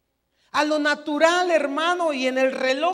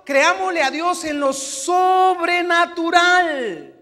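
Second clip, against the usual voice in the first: louder, slow emphatic preaching; fiery preaching; 3 semitones higher, 6 dB louder, slower than usual. Real, not acted. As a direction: drive it home to the congregation. Preaching at full force. (predicación enérgica y lenta)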